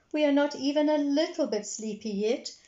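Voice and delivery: put-on high insistent little girl. A narrator's reading. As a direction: protest like a small girl. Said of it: "replied Peppa".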